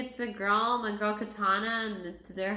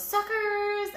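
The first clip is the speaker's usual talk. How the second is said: taunting shout; 11 semitones higher, 4 dB louder than usual. Retold or imitated. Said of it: "Scorpion goes".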